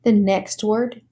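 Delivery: ordinary talk, nothing marked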